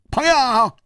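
a flustered man blustering high; flushed indignant. Acted. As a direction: stand up to him, face burning. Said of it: "涨红了脸说".

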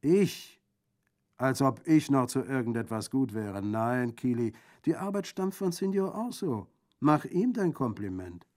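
high, loud, incredulous "me?"; jovial bandit, self-mocking. Bandit credits Orso to his niece, boisterous. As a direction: wave off the credit loudly, then praise.

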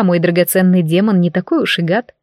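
plain reading voice

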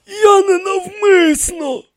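very high, slow priest voice; pleading explanation. A narrator's reading. High priest excuses himself to the pharaohs, plaintive. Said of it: "пояснив він".

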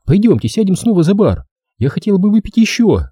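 high-pitched woman's voice; Della, insistent. A narrator's reading.

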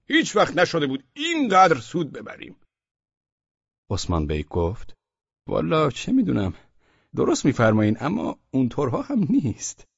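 a merchant who smells a trap; wary merchant. Acted. Merchant, then Osman Bey.

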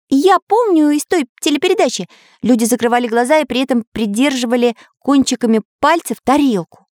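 high-pitched cartoon hero voice; keen artist character. Artist reading to his friends, high keen voice. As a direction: puzzle it out in a high, lively voice.

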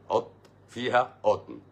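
each word set apart, slowly; slow emphatic repeat. Real, not acted. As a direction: spell out "حط فيها قطن" word by word. Spells the punchline out slowly.

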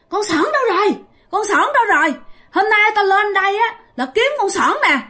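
high, strange ghostly demand; possessed girl voice. Retold or imitated. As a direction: speak as the ghost inside her, searching. (hồn ma nhập xác hỏi tìm Sỏn giọng cao lạ)

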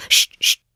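two sharp loud shushes; loud "sht, sht" to silence someone; shushing. A narrator's reading. Hatter and March Hare hush Alice, loud shushing. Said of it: "machten beide".

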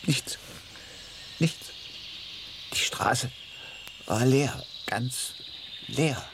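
breathy whisper of "Die Straße". Acted. Soldier whispering about the empty road. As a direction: hush it like a secret.

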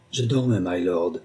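dull, hollow servant's reply; muffled voice. A narrator's reading. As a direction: speak it muted, as if from far off. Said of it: "d'une voix sourde".

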